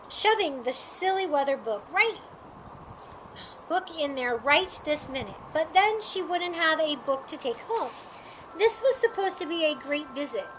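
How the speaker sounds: usual speaking voice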